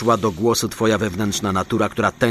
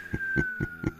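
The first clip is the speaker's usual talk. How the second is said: sinister laugh; 13 dB quieter than usual. Acted. Santa threatens, sinister laugh.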